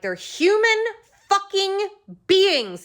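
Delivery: high, loud, slow on fucking beings; furious yell. Retold or imitated. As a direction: yell it at her, outraged. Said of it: "I yelled at her".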